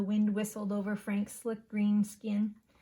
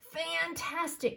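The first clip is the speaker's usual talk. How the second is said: a hint of a croak, higher and slow; lightly croaked; 9 semitones higher, slower than usual. A narrator's reading. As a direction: croak it out like a proud frog dad. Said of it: "croaked Dad".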